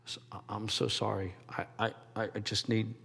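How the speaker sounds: low, soft mumbled apology; mumbling. Retold or imitated. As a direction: mutter it quietly, ashamed and broken. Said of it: "starts mumbling".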